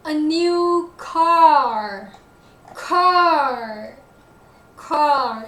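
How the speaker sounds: CAR loud and drawn out; heavy, lengthened emphasis on CAR; stress on CAR. Shown as a demonstration. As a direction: stretch and punch every CAR. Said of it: "It's also stress word".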